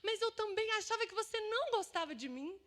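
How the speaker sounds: high, pleading outpouring; retold tearful confession. Friend confessing her hurt on an audio, imitated tearful plea. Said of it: "começou a chorar e falou".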